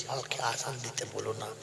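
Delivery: low, soft, sorrowful refusal; hushed, mournful pleading; piteous plea. Retold or imitated. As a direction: beg sadly to be spared. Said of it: "করুণ সুরে বলছে".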